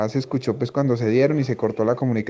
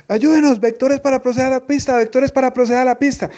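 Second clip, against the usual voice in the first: much higher, pleading imitation of a frantic pilot; desperate pilot; 11 semitones higher, 4 dB louder than usual. Pilot begging controller for vectors, desperate high voice. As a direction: beg for vectors like a pilot in panic. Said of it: "así la voz desespera".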